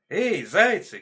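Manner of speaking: high, loud hail; calling out. A narrator's reading. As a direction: holler to the passing hares. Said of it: "кричит лиса".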